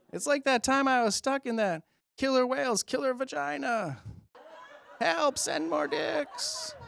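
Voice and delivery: very high, slow, squeaky female creature voice; girl Yeti impression. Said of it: "That's my impression of a girl Yeti".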